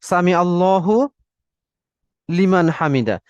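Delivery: "সামি আল্লাহু", a long break, "Liman Hamida"; separated words. Shown as a demonstration. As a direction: clear pause between "আল্লাহু" and "Liman". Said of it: "একটু separation করব".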